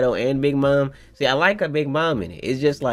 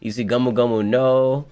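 ordinary talk, nothing marked

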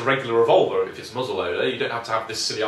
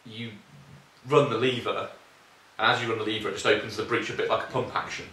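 ordinary delivery

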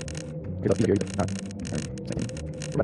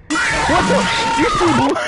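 high, loud shocked yelling; startled outburst; 12 semitones higher, 5 dB louder than usual. Real, not acted. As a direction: yell in sudden disbelief. Jump scare shock.

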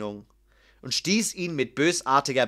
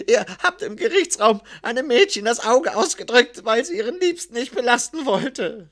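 high, anxious scribe's voice; frightened; 7 semitones higher, 4 dB louder than usual. A narrator's reading. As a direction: answer fearfully, pitched well up. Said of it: "antwortete der Schreiber verängstigt". (verängstigt, mit hoher Stimme)

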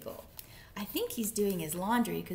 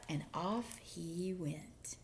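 usual speaking voice

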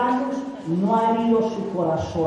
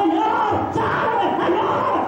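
much higher, a half-laughing plea; pleading to God; 9 semitones higher, slower than usual. Retold or imitated. Believer crying out to the Lord to be saved. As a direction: cry out to the Lord for rescue, lightly acted. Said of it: "yo puedo clamar".

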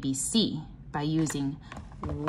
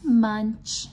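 lively sing-song read-aloud, chanted refrains higher; reading to children; 7 semitones higher, slower than usual. Acted. Teacher reading a pattern book to the class, bouncy sing-song chant. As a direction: chant the refrains like a nursery rhyme for little ones.

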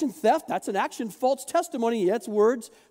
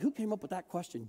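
mock muttering; 2 semitones lower, 11 dB quieter than usual. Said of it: "talking to each other like".

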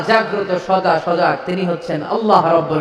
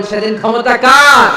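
higher, much louder demand; loud question; 6 semitones higher, 8 dB louder than usual. A narrator's reading. Preacher voicing God loudly asking whose power it is. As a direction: call it out across the hall. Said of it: "আওয়াজ করে বলেন".

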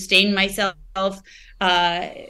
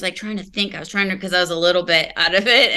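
ordinary delivery

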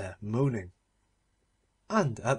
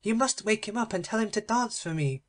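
high, petulant princess call; a spoilt princess calling to her uncle; cried out; 7 semitones higher than usual. Acted. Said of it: "she cried".

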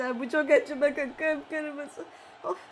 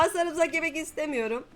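ordinary delivery

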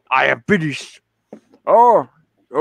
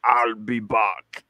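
low, slow action-hero catchphrase; imitation Austrian action star; Arnold Schwarzenegger; 5 semitones lower, 4 dB quieter, much slower than usual. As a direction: deliver it slow and low like a movie cyborg. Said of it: "with his famous quote".

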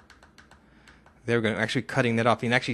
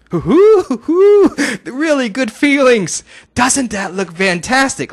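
loud, high whooping celebration; thrilled, slow high-pitched cheering; real excited; 12 semitones higher, 13 dB louder, much slower than usual. Real, not acted. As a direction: whoop and draw out the words with delight. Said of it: "Sorry, I got real excited there".

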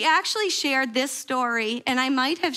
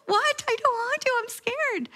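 high, panicky no-no-what; imitating a frightened woman pleading, pitch shooting up; mock fearful refusal, high and breathless; scared protest; 11 semitones higher than usual. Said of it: "She was like".

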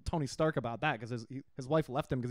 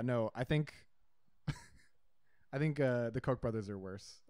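normal talking voice

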